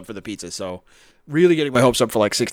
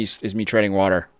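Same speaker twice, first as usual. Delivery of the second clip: usual speaking voice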